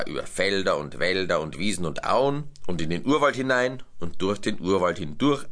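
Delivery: plain reading voice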